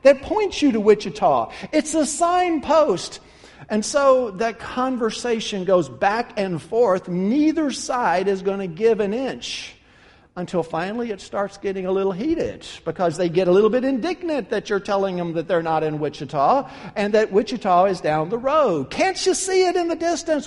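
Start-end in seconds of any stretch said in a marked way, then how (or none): 0.0-3.2 higher, disbelieving no, no; incredulous protest. Acted. Traveler protesting to the campers, incredulous. 18.9-20.5 much higher, pleading and heated; exasperated question. Acted. Traveler arguing with the campers, exasperated. Heated back and forth argument.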